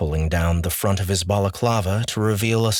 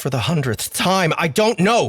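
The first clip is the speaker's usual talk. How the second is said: raised fed-up retort; exasperated; 9 semitones higher than usual. Acted. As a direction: snap it back, fed up. Exasperated character reply.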